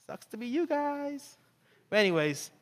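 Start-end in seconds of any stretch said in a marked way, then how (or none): 0.1-1.4 high, slow gloating brag; much higher, slow mocking taunt; smug Haman. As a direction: rub it in like a show-off. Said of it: "this is [name] version".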